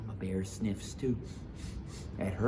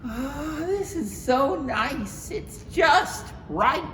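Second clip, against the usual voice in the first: put-on high, slow contented little girl; Goldilocks voice; 5 dB louder, much slower than usual. Goldilocks snuggling into bed, pleased and high. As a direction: sigh happily into the small bed.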